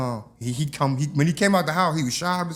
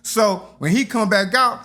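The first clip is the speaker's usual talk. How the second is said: usual speaking voice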